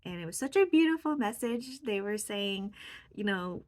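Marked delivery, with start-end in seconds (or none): none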